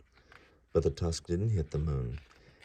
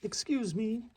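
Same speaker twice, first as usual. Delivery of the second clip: much higher squeaky interruption; squeaky mouse; slower than usual. Acted. Mouse interrupting Vyasa, a high squeak. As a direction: butt in like a tiny mouse. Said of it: "said Mister Mouse".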